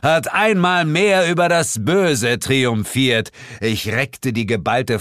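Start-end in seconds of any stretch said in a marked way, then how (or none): 0.0-3.3 slow, high, grandiose proclamation; hammed-up heroic speech; triumphant shout. A narrator's reading. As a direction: declaim victory to the skies. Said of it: "schrie ich laut".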